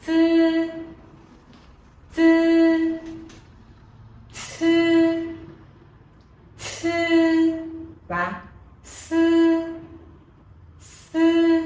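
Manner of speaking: "Chư, chư, chư" and "sư" with the lips spread as if smiling; flat-lip demo. Shown as a demonstration. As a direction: spread the lips a little on each syllable. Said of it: "miệng của chúng ta sẽ hơi bẹt, hơi cười ra một chút". (đọc "Chư, sư" miệng bẹt, hơi cười)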